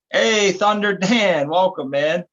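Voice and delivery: slow, loud, high welcome call; drawn-out greeting. Real, not acted. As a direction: stretch out the greeting like an announcer. Greeting a viewer joining.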